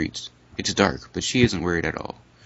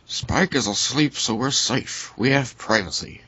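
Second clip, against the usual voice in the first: higher, slower put-on friend voice; anonymous friend voice; 5 semitones higher than usual. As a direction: keep the mystery friend's high voice. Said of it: "That's the anonymous friend voice".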